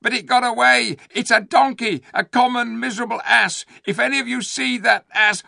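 high, loud screaming ape. A narrator's reading. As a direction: shriek the accusation. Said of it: "screamed the ape".

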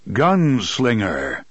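slow, drawn-out boom from all around; a machine voice booming through a city. A narrator's reading. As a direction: boom it out like a god in the walls. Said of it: "a voice boomed from everywhere".

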